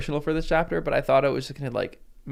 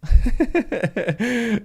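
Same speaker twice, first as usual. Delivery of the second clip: bursting laugh; 9 semitones higher, 6 dB louder than usual. Real, not acted. Laughing at her reading plan.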